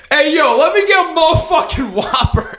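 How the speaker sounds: put-on half-laughing drunk yell at the drive-thru window; yelling a drive-thru order. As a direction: hammer out the order like a rowdy drunk. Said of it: "I lean across him to start yelling".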